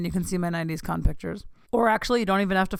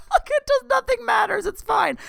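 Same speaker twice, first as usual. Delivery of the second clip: much higher and louder, laughing through the words; carefree, laughing it all off; giddy laugh; 5 dB louder than usual. Real, not acted. Laughing, giddy about nothing mattering.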